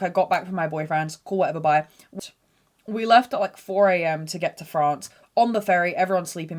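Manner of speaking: normal talking voice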